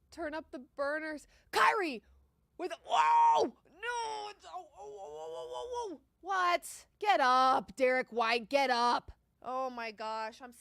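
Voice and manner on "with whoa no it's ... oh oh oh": rising oh-oh groans; anguished groans. Real, not acted. Bettor groaning at the TV as the bet slips, anguished moans. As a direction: moan as the play goes wrong. Reacting to a play.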